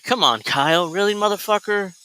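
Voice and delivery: hammed-up annoyed wife voice; mock exasperated wife. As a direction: play the fed-up wife who wants her vacation. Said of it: "She's like".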